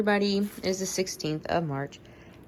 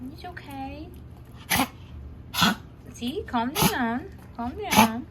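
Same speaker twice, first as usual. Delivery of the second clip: higher, lilting reassurance; soothing pet talk; 6 semitones higher, slower than usual. Real, not acted. Owner calming a dog who got upset, cooing.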